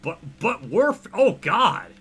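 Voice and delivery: loud, high, stunned yell of disbelief; shocked outburst. Real, not acted. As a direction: blurt it out, floored by what appears. Shocked reaction to game screen.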